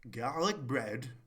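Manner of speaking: slow, loud, drawn-out comic catchphrase; Peter Kay impression. Mimicking a comedian's catchphrase for the viewers, slow and loud. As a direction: stretch the words out big for the laugh. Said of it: "I'm gonna do a Peter Kay impression".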